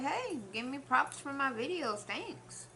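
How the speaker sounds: playful. Retold or imitated. Playful self-praise.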